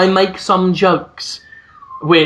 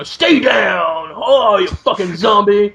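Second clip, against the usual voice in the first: slow, drawn-out imitation of a shout; half-shouted game catchphrase, stretched out; mock battle cry; 3 semitones higher, slower than usual.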